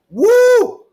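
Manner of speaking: loud high whoop of triumph; excited whoop. Real, not acted. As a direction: let out a big victorious woo. Celebrating after chugging water.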